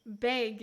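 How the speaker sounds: "Bag" said as "beg"; Canadian "bag". Shown as a demonstration. Showing Canadian speech, "Bag" like "beg". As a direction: raise the vowel of "Bag" to "beg". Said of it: "they say bag".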